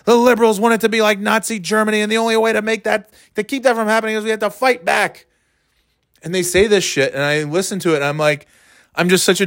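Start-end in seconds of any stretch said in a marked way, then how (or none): 0.0-5.3 mock pundit rant. Mocking radio host talking points.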